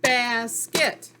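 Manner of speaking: BASKET loud, in two even beats; clapped-out syllables. Shown as a demonstration. Teacher claps out the word with pupils, syllable by syllable. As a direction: punch BAS and KET as separate syllables. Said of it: "Clap it out".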